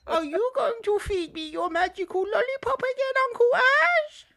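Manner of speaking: put-on creepy little-girl tease; childish girl voice.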